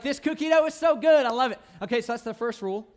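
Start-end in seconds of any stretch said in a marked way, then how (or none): 0.0-1.6 high, loud, gushing over cookie dough; gushing delight. Retold or imitated. Kid eating cookie dough, loud and high with delight. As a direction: swoon over the taste, hammed up. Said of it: "You're like".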